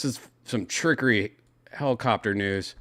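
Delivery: normal talking voice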